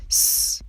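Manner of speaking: a hissed, voiceless "س"; voiceless s sound. Shown as a demonstration. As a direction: let "س" hiss out with no voice. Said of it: "آخرین صدایش بدون لرزه است".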